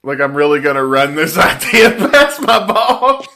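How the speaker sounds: loud incredulous outburst breaking into laughter; laughing disbelief. Real, not acted. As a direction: shout it while cracking up. Cracking up at caller's suggestion.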